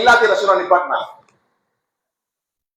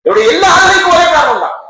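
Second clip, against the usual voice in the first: louder emphasis; 9 semitones higher, 4 dB louder than usual. Acted. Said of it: "repeated for emphasis".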